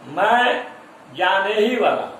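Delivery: stressed "जाने ही", demonstrated for students; emphatic stress. Shown as a demonstration. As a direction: lean firmly on "जाने ही" to show emphasis. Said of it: "जोर देकर के कहते हैं".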